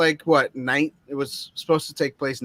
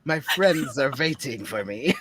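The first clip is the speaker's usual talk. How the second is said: mock German accent; 2 semitones lower, 4 dB quieter than usual. Quoting the German in the bar, put-on German accent. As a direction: imitate the film's German pilot. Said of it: "when the German came into the, into the bar".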